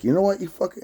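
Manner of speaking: shouted high and sharp at her; angry retort. Retold or imitated. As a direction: fire back at her in a rising fit of anger. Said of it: "And then I was like".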